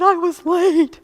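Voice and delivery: loud, high wailed confession; hysterically crying. Retold or imitated. Patient answering doctor's what's wrong, hysterical wail. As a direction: imitate blurting it out in a bawl. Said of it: "I'm, like, hysterically crying".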